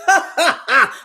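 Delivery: loud laughing gloat; mocking Nell's told-you-so laugh. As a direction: laugh it out triumphantly. Nell gloating over the admission.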